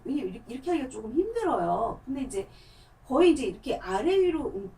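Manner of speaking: ordinary talk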